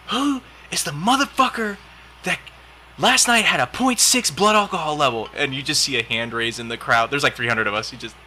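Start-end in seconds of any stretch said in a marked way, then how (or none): 0.1-5.3 high, loud drill commander yell; angry commander. Retold or imitated. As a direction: bark it at the whole formation. Commander yelling at formation.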